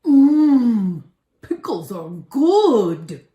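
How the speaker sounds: loud slow savoring goblin voice; greedy fat goblin. Acted. Fat goblin savors the sour pickle, slow loud relish.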